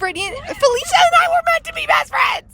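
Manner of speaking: very high, shouted squeal; excited squeal. Real, not acted. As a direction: squeal it out with joy. Excited about best friends.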